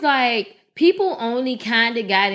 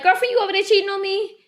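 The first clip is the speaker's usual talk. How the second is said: high, quick mock accusation; mock jealous girlfriend to her friend; teasing jealous girlfriend; 8 semitones higher, faster than usual. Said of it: "She be like".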